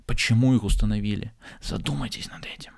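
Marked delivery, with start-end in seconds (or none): 1.6-2.7 dramatic breathy whisper. Acted. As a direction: lean in and whisper it. Motivational talk, dramatic aside.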